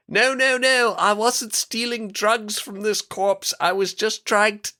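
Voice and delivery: high, hammed-up Australian accent for a retold scene. Friend retelling a game scene, mock Australian yelling. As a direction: yell it like a mock Australian in denial. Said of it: "are you doing a British accent again".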